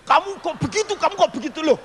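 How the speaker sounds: high, scolding father chasing his son; angry father. Retold or imitated. Father chasing the boy, scolding him high. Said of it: "papa saya ngejar-ngejar".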